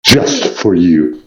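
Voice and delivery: lightly voiced sinister villain; sibilant villain voice. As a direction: purr it like a scheming villain. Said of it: "the whole sibilant villain voice thing".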